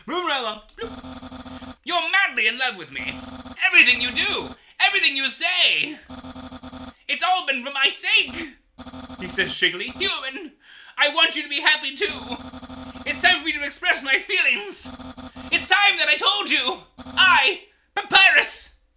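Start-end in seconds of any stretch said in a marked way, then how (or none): 0.8-8.5 loud, high, boastful skeleton chef; theatrical bragging skeleton shouting; Papyrus voice. Acted. 9.9-18.8 loud, high, boastful skeleton chef; theatrical bragging skeleton shouting; Papyrus voice. Acted.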